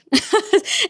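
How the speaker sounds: sudden high-pitched giggle; short high laugh at her own bad luck. Real, not acted. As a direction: burst into a bright rueful laugh. Laughs about hacked channel.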